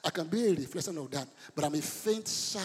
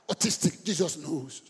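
ordinary talk, nothing marked

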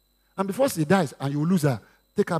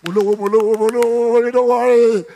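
loud, swaggering mo lówó, getting louder; boastful shout; 3 semitones higher, 8 dB louder than usual. Acted. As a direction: brag it, louder each time. Husband boasting he has money.

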